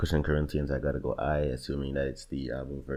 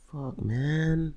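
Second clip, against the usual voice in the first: much higher pitched, anguished; exasperated cry; 12 semitones higher than usual. Real, not acted. Stuck picking a favorite, cries out in exasperation. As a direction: throw the words up in despair. Agonizing over the bracket.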